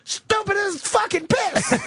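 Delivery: high, slow mock yell; hammed-up outraged friend bellowing; shrieking imitation of an angry buddy; mock furious yell. Retelling Keith's angry reaction.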